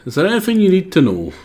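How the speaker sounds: wing a voice for a new character; makeshift character voice. Acted. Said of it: "I don't think I had a voice for this guy".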